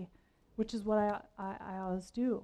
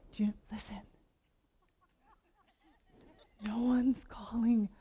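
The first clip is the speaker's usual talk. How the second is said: mock whisper. Dinner companion retold whispering at the table, hushed. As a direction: whisper as if not to be heard. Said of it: "one of them goes".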